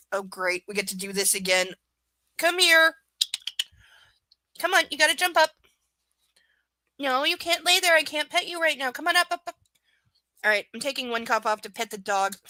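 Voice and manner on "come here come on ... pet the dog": high, loud pet-talk coaxing; coaxing the dog. Real, not acted. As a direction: cheer the puppy on to jump up.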